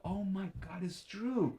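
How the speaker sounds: high-pitched retold amazement; astonished realization. Imitating friends astonished that it's true.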